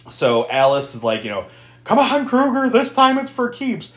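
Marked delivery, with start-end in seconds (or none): 1.8-3.9 mock defiance. Acting out Alice challenging Freddy.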